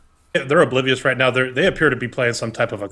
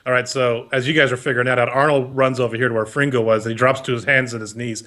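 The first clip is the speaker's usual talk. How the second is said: normal talking voice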